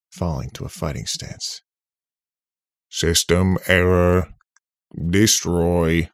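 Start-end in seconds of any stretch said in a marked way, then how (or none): 2.9-6.1 loud, monotone retro robot; slow, mechanical robot warning; old-fashioned robot voice. A narrator's reading. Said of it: "in an old-fashioned robot voice".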